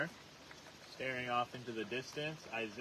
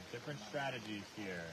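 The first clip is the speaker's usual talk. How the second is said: usual speaking voice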